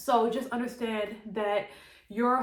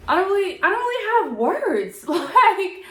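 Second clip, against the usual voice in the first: high, loud laughter breaking through the words; laughing in disbelief; 9 semitones higher, 9 dB louder than usual. Real, not acted. Vlogger telling viewers she cut her hair, laughing high and loud. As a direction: laugh it out, too stunned to finish. Stunned after cutting hair off.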